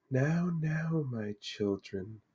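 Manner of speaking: slow, soft, soothing seer; serene Lady of the Lake. Acted. Mystic lady to the wary party, serenely calming. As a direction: soothe them softly, with long pauses. Game master voices the Lady.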